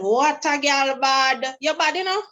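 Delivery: slow, high imitation of a Jamaican accent. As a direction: drawl it out in a playful Jamaican accent. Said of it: "in his Jamaican accent".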